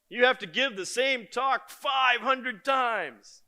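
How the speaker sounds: emphatic shout. Real, not acted. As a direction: shout it slowly to the hall. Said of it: "emphatic shout to audience".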